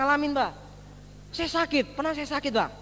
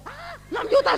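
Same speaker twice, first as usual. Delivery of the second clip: much higher, louder shocked echo of the price; shocked repeat; 12 semitones higher, 5 dB louder than usual. Retold or imitated. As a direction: gasp it out in disbelief at the bill. Kaget mendengar biaya. (kaget sekali mendengar harga enam juta)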